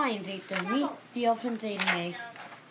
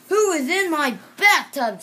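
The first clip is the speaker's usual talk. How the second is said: loud, higher indignant cry; indignant owner; 7 semitones higher, 11 dB louder than usual. Acted. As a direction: demand who's in your bathtub. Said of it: "said someone".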